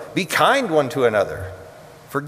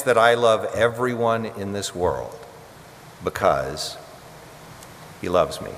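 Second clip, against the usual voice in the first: responsive litany; 2 semitones lower than usual. Acted. Preacher leading the congregation's response, hushed. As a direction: lead the church through the litany, answering gently.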